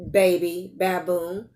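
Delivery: usual speaking voice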